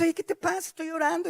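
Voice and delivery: high-pitched mock annoyance; annoyed protest. Person interrupted in prayer, acted annoyed protest. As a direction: object to being interrupted.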